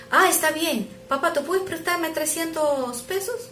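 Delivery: put-on high, hopeful little boy asking a favor; child's voice. Son asking his dad for money, high child voice.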